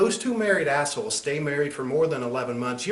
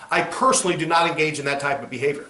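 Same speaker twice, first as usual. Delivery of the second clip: ordinary talk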